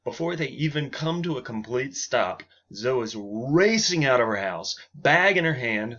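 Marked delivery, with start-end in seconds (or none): none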